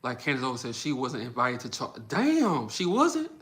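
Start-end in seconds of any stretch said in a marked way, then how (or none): 2.1-3.3 sudden high pitched disbelief; shocked high squeak of disbelief; surprised. Real, not acted. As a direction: react like you can't believe it. Reacting to chat news.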